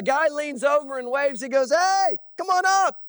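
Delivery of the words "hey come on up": high, loud, slow mocking call; taunting enemy. Enemy soldier waving down to Jonathan, loud mocking call.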